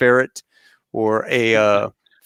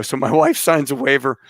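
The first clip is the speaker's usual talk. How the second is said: ordinary delivery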